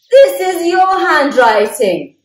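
loud indignant protest. Acted. Bear protests to Mouse, loud and indignant. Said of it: "protested Bear".

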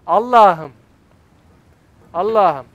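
loud, falling cry of exasperation; exasperated outcry. Real, not acted. Player to co-op partner after a slip, exasperated. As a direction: cry out to God in frustration.

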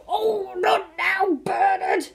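high, loud, slow exasperated father; distracted dad. Dad with a hurt finger waves Bernard away, exasperated. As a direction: brush the boy off mid-pain.

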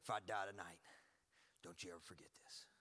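low, soft, grave warning; quiet intensity of an aggressive father; imitating warrior dad. Warrior dad charges his son to remember, imitated grave warning. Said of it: "He goes".

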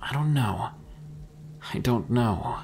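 softly deflating, halting answer; dejected. A narrator's reading. Mika cools down after his outburst, dejected. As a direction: trail down into dejection, pausing between phrases. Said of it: "Mika dejectedly stated, cooling down".

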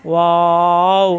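ওয়াও stretched long on a level note; ওয়াও, sustained slowly; drawn-out letter name. Shown as a demonstration. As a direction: hold the vowel of ওয়াও, rounding at the end. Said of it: "এখানে কিন্তু টান দেওয়া যায়".

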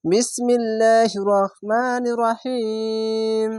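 slow, melodic chanted recitation; drawn-out Arabic recitation, voice carrying; Quran recitation. Shown as a demonstration. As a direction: recite it slowly in tajweed style. Recited basmala.

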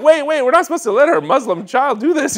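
high, loud mock protest; imitated alarmed mother. Mother objecting to dad's permission, hammed-up alarm. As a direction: play a frantic mom objecting, pitch way up.

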